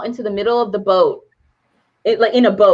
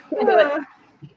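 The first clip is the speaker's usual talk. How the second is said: "Can't do it" stretched slowly and higher; drawn-out high; 8 semitones higher, slower than usual. Shown as a demonstration. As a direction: draw out "Can't do it" in high exasperation.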